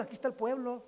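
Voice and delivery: usual speaking voice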